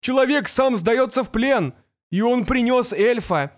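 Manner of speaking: high, carrying shout from afar; distant shout. A narrator's reading. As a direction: call out excitedly from a distance. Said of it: "донёсся крик".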